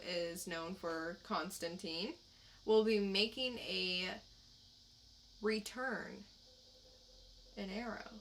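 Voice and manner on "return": ordinary delivery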